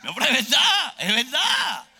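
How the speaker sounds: high, loud protest to the crowd; emphatic high shout; shouted insistence. Real, not acted. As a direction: yell it out in comic earnest. Preacher insists over laughter.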